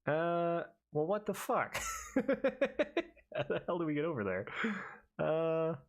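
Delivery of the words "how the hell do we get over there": normal talking voice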